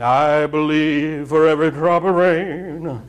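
slow crooning [name] croon on the lyric; [name] impersonation. Retold or imitated. Preacher mocking a song he calls stupid, slow [name] croon. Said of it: "That's a pretty good [name] impersonation".

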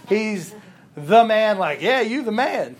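loud, high "you the man"; admiring praise. Shown as a demonstration. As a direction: hype a friend up. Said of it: "or if I say".

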